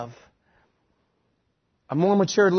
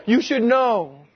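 higher, louder retort; indignant wife; 6 semitones higher, 5 dB louder, much slower than usual. Retold or imitated. Wife answering her clueless husband, indignant. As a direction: throw it back as an obvious reproach.

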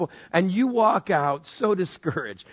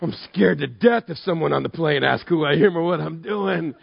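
mock dismay; 3 semitones lower than usual. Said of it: "we sit there and go".

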